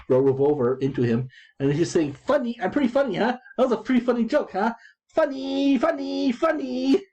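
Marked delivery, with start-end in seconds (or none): 2.1-7.0 high manic taunting hitman voice; Rocco the hitman. Retold or imitated. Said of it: "the hitman Rocco goes".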